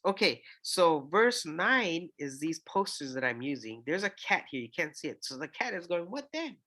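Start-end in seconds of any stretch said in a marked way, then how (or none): 6.1-6.6 high squeaky cat asking. Pretend. Memory-poster cat asking a question, high squeaky cat voice. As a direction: voice the curious cartoon cat.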